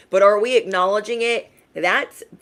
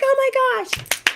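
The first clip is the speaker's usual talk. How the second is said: much higher, frantic emergency voice; flustered mom yelling in an emergency; impatient panicked yelling; 9 semitones higher, faster than usual. Retold or imitated. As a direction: bark orders in a panic, very high. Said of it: "I'm like".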